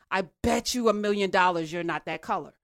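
usual speaking voice

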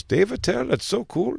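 high, soft mock Indian accent. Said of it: "Indian guy".

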